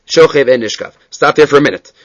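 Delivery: ordinary delivery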